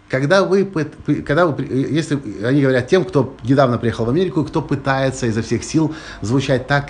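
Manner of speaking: usual speaking voice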